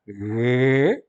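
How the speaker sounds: loud, sustained voiced guttural "re". Shown as a demonstration. Teacher showing the Greek voiced guttural, drawn out and voiced. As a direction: hold "re" out with the voice on. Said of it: "a gutural sonora".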